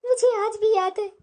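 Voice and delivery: ordinary delivery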